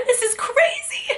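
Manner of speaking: fighting back real tears, voice breaking; starting to cry. Real, not acted. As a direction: voice cracking up high as tears come. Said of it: "I'm gonna start crying again".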